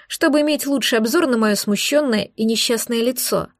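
ordinary delivery, nothing marked

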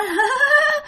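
high eerie ghostly laugh; shrill cackle of a ghost woman. Retold or imitated. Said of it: "bà cười".

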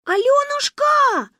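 very high, slow loud call; thin-voiced call. Manya calls the girl in a thin high voice. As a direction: sing out the name in a thin high voice. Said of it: "тоненьким голосом позвала Маня".

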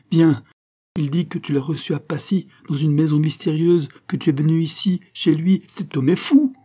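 high, loud, breathless-paced report; urgent outrage. Acted. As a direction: drag her along, scandalised and insistent. Cerise urgently summons her sister.

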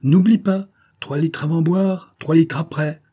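loud parting shout, pitched well up; shouting orders. A narrator's reading. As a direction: holler a reminder to the stable hand. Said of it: "une voix qui criait au valet d'écurie".

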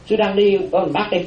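normal talking voice

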